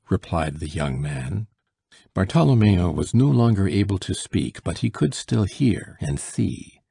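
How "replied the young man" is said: plain reading voice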